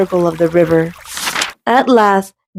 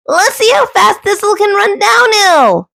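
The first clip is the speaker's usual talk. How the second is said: high shouted call; shouting; 13 semitones higher than usual. Acted. Duck shouting to Goose about Thistle, high. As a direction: call it out eagerly.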